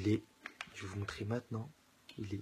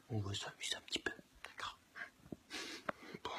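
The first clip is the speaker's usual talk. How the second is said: whispering; 4 semitones lower, 8 dB quieter than usual. Real, not acted. Sneaking up on sleeping girlfriend.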